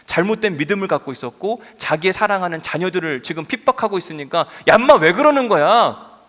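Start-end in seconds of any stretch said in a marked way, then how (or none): 4.7-6.2 imagined angry rebuke, loud and drawn out; angry scolding. Acted. Preacher imagines Jesus scolding Paul, angry scolding. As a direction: raise your voice high and scold a wayward youngster. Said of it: "라고 혼내는, 역정 내시는 모습으로".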